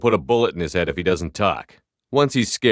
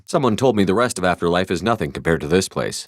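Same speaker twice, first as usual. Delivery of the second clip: ordinary talk, nothing marked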